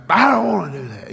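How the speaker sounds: high, mock child's scream at no extra volume; retold scream. Boy talking back to his mom, mild mock scream. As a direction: pitch it up like a whiny yell back. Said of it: "I think I screamed back".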